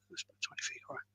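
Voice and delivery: hushed mutter before calling out; whispered aside. Retold or imitated. Old man whispers while pacing away from wife. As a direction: whisper it to himself, measuring the distance.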